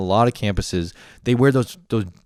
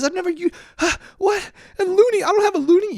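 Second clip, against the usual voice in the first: very high flustered voice; panicked squeak. Retold or imitated. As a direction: squeak it in total confusion. Panicked high retold self.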